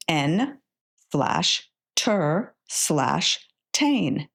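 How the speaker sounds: slow, each syllable apart; syllables sounded out slowly. Shown as a demonstration. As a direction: tap out each chunk slowly for a child. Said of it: "sound out the syllables".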